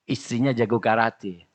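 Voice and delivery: ordinary talk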